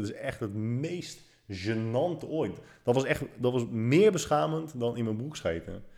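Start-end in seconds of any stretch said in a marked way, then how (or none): none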